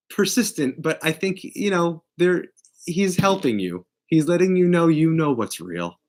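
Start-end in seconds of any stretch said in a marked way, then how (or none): none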